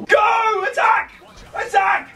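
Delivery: rally the troops, charge!. Acted. Yelling orders at player in game.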